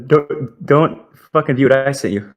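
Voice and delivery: usual speaking voice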